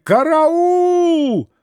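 put-on high, slow shrieking old man; old man's wail. A narrator's reading. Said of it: "завопил старик".

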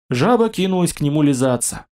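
plain reading voice

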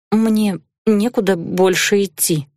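ordinary delivery

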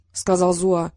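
usual speaking voice

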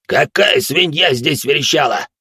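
vile, slow demonic voice; demonic layered voice. Acted. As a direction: growl it like a nasty demon. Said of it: "мерзкий демонический голос".

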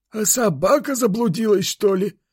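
put-on high character voice for an old man; grandfather. A narrator's reading. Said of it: "предположил дед". (высокий стариковский голос)

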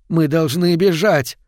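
urgent shout. A narrator's reading. Mother shouting to her boy over the blizzard, urgent shout. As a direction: call out over a howling wind. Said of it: "крикнула мать, стараясь перекричать".

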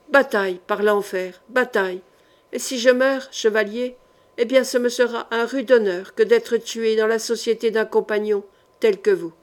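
ordinary delivery

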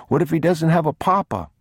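put-on high child's plea; worried little boy. A narrator's reading. Boy fearing the other child is alone, high worried question. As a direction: ask it high and anxious.

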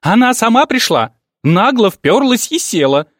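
high, loud furious outburst; stamping rage. Acted. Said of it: "затопала ногами маменька".

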